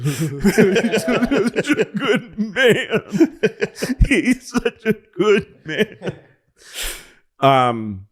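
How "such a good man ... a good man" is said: high, slow, tearful through laughter; laugh-sobbing. Retold or imitated. Recalling weeping at the TV over the Marine, high, slow and laughing. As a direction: gush while half laughing, half crying.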